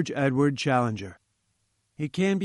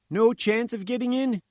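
higher, eager reporter voice; a fat little newsman begging for his story; pleading journalist; 9 semitones higher than usual. A narrator's reading. Roy's held high voice.

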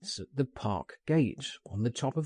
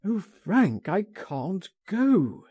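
high, loud and slow, pleading; a distressed friend refusing, voice high and drawn out; anguished cry; 7 semitones higher, 3 dB louder, slower than usual. Acted. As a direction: cry it out in despair. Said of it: "he cried".

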